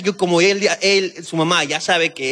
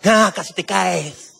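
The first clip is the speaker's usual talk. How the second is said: put-on playful baby talk; much slower than usual. Playing with the baby.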